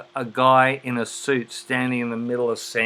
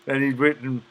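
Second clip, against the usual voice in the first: usual speaking voice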